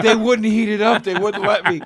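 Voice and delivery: put-on high, laughing drunk excuse. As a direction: lie it drunkenly. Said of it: "And I go".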